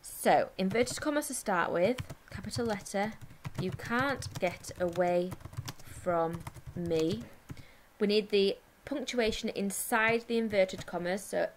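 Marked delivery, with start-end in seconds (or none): 3.6-7.2 word by word, paced for writing; slow dictation. Shown as a demonstration. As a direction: dictate it slowly with gaps. Dictating the sentence while writing.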